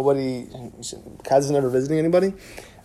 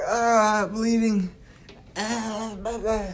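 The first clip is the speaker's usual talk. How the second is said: mock dying gasps; 6 semitones higher, 4 dB quieter, much slower than usual. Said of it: "like this".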